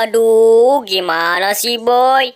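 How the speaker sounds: ordinary talk, nothing marked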